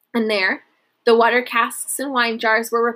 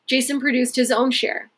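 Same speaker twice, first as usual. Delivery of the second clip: plain reading voice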